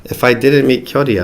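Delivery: usual speaking voice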